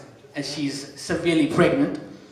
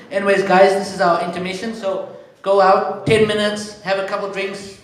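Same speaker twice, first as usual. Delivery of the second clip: louder, upbeat host announcement; intermission announcement; 3 semitones higher, 5 dB louder than usual. Acted. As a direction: send the crowd out on break.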